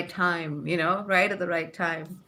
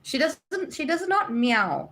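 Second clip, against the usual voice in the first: normal talking voice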